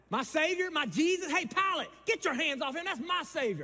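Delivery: high, loud rallying cry. Acted. Crowd cries out for their Savior, loud and high. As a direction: shout it out like the crowd.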